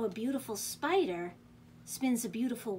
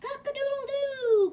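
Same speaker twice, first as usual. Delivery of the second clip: high, loud crow with a long held end; rooster crowing; 7 dB louder than usual. A narrator's reading. Reader voicing the rooster for children, crowing. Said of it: "crowed the rooster".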